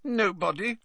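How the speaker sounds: put-on high-pitched moody ghost girl; high, sullen little reply. A narrator's reading. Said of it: "picking moodily at a spot".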